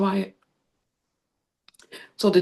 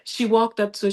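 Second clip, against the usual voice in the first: usual speaking voice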